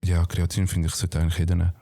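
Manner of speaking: ordinary talk, nothing marked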